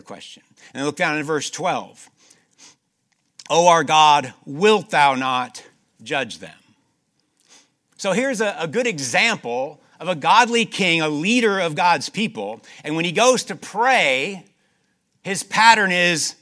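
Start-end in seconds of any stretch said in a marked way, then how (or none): 3.5-6.6 loud, ringing scripture reading; preaching at full force. Acted. Preacher reads a king's prayer aloud, loud and ringing. 8.0-16.3 loud, ringing scripture reading; preaching at full force. Acted. Preacher reads a king's prayer aloud, loud and ringing.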